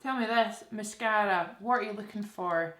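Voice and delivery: usual speaking voice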